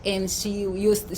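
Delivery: ordinary delivery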